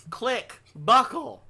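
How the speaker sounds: high-pitched clicking noises; ride sound effects. Pretend. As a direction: mimic the seatbelt clicking shut. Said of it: "I'm gonna do it in sound effects".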